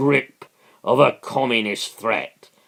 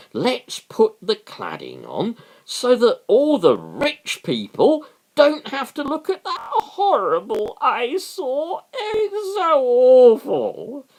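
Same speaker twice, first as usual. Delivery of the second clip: mock snooty rich person; 8 semitones higher than usual. Mocking rich residents who hate the eyesore, in a lightly snooty voice.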